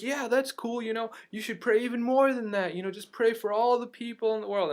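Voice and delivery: high, loud imitation of a tipsy man; mock-yelling drunk bystander; drunk stranger. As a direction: call it out as a boisterous drunk. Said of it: "he obviously had been drinking a lot".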